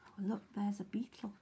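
fast hushed whisper. Real, not acted. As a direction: whisper it to the listeners. Aside while reading.

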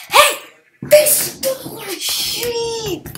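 high, loud outraged yell; outraged shout. Real, not acted. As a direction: yell at the thieves. Sheep stolen.

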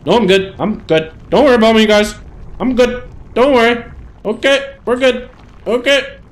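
high, loud, short bursts of insistence; mock drunken reassurance. Drunk character telling the guys not to worry, mock high-pitched reassurance.

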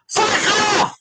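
high, explosive yell; furious dismissal. Real, not acted. Sending off someone in a row, furious shout. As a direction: scream them away angrily.

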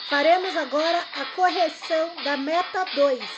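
loud, upbeat welcome to the class; rally the students loudly as the lesson kicks off; excited class greeting. Real, not acted. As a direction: enthusiastic teacher opening. Upbeat greeting to class over music.

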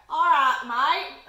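loud, badly faked Australian accent. Shown as a demonstration. Taster demonstrating how Australians talk, mock Australian accent. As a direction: squint into a hammed-up Aussie accent. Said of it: "an English accent with their eyes squinted".

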